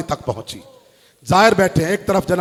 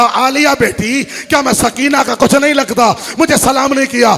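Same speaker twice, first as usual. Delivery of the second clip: louder tearful complaint; weeping; 6 semitones higher, 8 dB louder than usual. Acted. Ali asks the girl, loud and tearful. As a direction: plead through mild tears. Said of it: "रोकर कहा".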